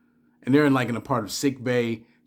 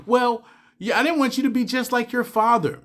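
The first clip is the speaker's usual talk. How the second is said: a lightly voiced woman, pitched much higher; a hint of Beverly, higher, defensive; voicing Beverly; 9 semitones higher than usual. Beverly tells Picard why she kept his son secret, lightly voiced higher.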